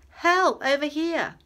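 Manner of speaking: very high, very loud cry for help; calling for help. A narrator's reading. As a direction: call for help across the water. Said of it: "calling out".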